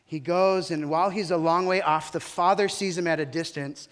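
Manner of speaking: loud, driving sermon storytelling; preaching at full force. Acted. Pastor telling the prodigal son to his congregation, preaching loud. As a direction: push the story out to the whole room.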